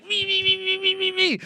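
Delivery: very high, rapid squeaky repetition; hammed-up eager volunteering.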